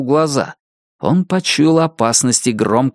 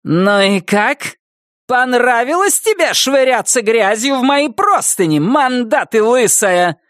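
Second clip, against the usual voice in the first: furious sneer; 11 semitones higher than usual. Acted. Wilma accusing Nettie about the sheets, furious. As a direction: sneer it out in rage. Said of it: "Вильма была вне себя от ярости".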